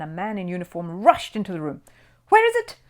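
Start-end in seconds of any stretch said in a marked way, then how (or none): 2.3-2.8 high, loud urgent shout; anxious cry. A narrator's reading. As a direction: call it out in alarm, bursting in. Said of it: "he cried".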